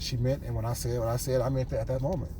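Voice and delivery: ordinary talk, nothing marked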